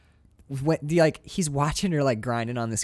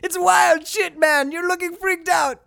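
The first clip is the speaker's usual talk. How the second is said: frantic director; 9 semitones higher than usual. Pretend. Director urging Tim Robbins on, hammed-up high. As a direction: push the actor harder, pitched way up.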